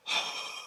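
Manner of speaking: a soft unvoiced gasp; breathy gasp. Real, not acted. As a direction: suck in air in disbelief. Trip about to sell out.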